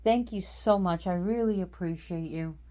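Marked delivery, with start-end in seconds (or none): none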